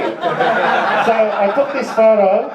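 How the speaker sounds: ordinary talk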